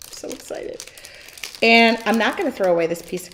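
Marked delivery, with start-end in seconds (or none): 0.1-0.8 breathless whisper of delight; whispered excitement. Real, not acted. To viewers about her paint set, a thrilled whisper. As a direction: hush it like a happy secret.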